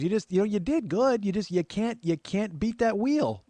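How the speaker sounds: high neighbor lady voice; Dottie. Retold or imitated. Neighbor consoling a game-show loser, high character voice. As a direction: draw out the verdict in a high woman's voice. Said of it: "And she goes".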